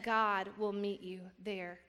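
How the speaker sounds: usual speaking voice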